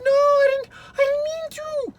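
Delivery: high, loud frightened denial; desperate to undo it; panicked denial. Acted. Maria pleads after accident.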